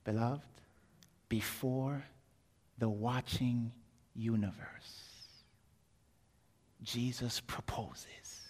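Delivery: soft and slow, fading towards a whisper; hushed solemn narration. A narrator's reading. Preacher telling the congregation of the proposal, hushed. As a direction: let each phrase land quietly, with pauses.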